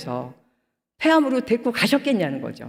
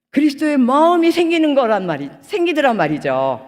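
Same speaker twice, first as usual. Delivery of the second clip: higher, louder preaching push; preaching emphasis; 5 semitones higher, 4 dB louder than usual. Real, not acted. Preacher stirring the congregation, raised emphatic preaching. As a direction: drive it home to the congregation.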